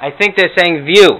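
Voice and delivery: put-on high, loud, confused old grandma. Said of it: "some Morty and Herta imitations".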